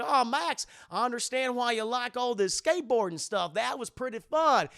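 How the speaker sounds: high, loud-starting cartoon dad; enthusiastic Goofy. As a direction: gush fondly to his son. Voices Goofy praising skateboarding.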